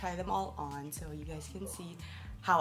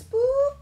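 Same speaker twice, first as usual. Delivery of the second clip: high, loud mock scare; playful boo; 12 dB louder than usual. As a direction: pop out like a surprise. Reveals last item playfully.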